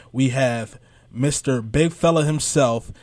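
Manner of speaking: normal talking voice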